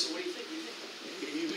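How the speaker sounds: low, hushed sales nudge; car salesman. Acted. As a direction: lean in quietly for the close. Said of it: "It's kinda like a car salesman".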